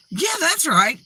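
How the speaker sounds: high, emphatic agreement; imitated tone change. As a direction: mimic suddenly jumping on the bandwagon. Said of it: "the tone changes with human beings".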